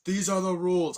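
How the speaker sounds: booming mock announcement; imitated arena announcer. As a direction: proclaim the rules to a whole stadium. Cranky announces the rules to arena.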